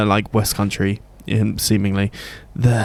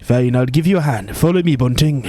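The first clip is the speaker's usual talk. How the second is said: lightly voiced Welsh fairy guide; cheeky Welsh lilt, setting the task; a hint of a Welsh accent; 4 semitones higher than usual. Welsh fairy character lines.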